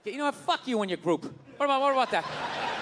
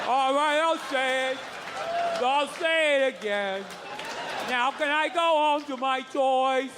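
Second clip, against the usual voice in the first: mock obedient child; 3 semitones higher, slower than usual. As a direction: whine it back like a bored little boy. Child answering teacher, slow.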